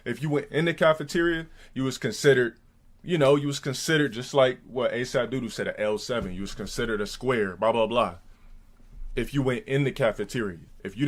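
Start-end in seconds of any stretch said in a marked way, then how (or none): none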